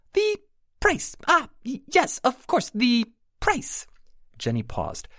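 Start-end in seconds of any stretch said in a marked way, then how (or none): 0.1-3.9 very high fairy voice, stumbling over herself; a tiny creature correcting her own name; flustered pixie. A narrator's reading. Pixie covers her confusion.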